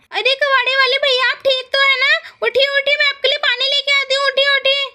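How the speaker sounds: high-pitched, fussing concern; concerned daughter-in-law. Acted. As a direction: urge the injured hawker up with acted worry. बहू घायल को संभाल रही है. (चिंता भरी ऊँची आवाज़)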